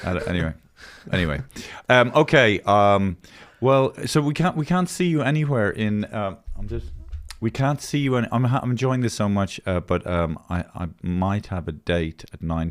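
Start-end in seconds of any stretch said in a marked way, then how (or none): none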